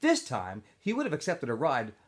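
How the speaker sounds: expressive read-aloud of a chapter; story narration. A narrator's reading. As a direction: tell the story to a classroom. Teacher reads novel aloud.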